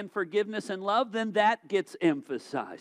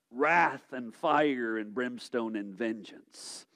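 slow, lower, heavy doom preaching; mock fire and brimstone; 8 semitones lower, slower than usual. Pastor mocking preachers who swing to all wrath, slow and doomy. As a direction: drag out each word like a hellfire preacher. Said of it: "Let's now preach nothing but".

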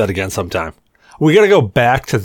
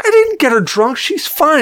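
high, defensive protest; mock indignant denial to the bartender. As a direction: insist you did nothing. Speaker denies accusation.

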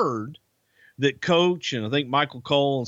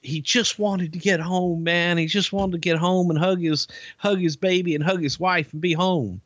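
normal talking voice

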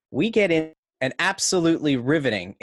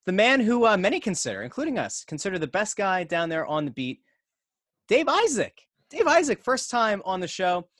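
announcer; 5 semitones higher than usual. Acted. Announcing the bonus guest.

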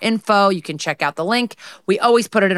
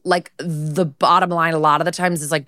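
normal talking voice

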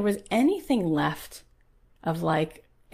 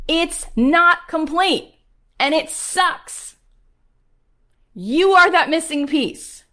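high, loud fired-up preaching; rally the crowd like a preacher; preaching at full force; 8 semitones higher, 6 dB louder than usual. Acted. Preaching to her audience.

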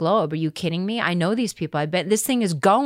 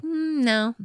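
mock breezy high refusal, pitched up; airily brushing her off; high, light imitation of producers saying no; dismissive producers; 11 semitones higher, slower than usual.

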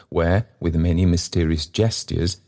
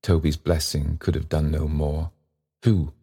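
usual speaking voice

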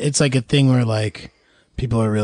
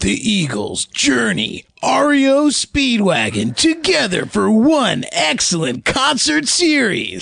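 slow, higher promo voice; concert ad announcer; 8 semitones higher, slower than usual. Pretend. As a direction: hype it like a radio ad.

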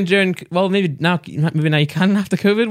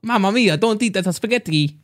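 slow, hammed-up Italian mamma; mock Italian accent; 4 semitones higher than usual. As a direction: stretch it out like a cartoon Italian. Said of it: "He's turned different".

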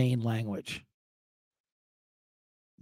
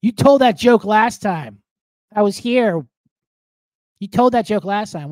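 louder, higher heckler's complaint; complaining audience member; 5 semitones higher, 9 dB louder than usual. Retold or imitated. Audience member confronting the comic about a repeated joke, loud heckler's complaint. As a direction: call it out as an accusing heckler. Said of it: "People do that to me".

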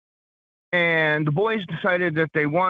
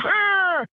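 imitated scream. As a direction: imitate a startled nurse's shriek. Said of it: "She screams".

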